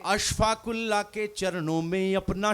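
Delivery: measured recited couplet, each line dwelt on; poem recitation. Acted. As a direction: recite it like a stage poet. Poet reciting verse.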